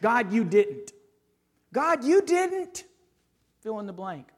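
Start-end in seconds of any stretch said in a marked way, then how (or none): 0.0-0.9 firm, complaining prayer to God. Pretend. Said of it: "Maybe this first prayer". 1.7-2.8 high, slow, aggrieved complaint to God; aggrieved prayer. Pretend. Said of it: "Maybe this first prayer".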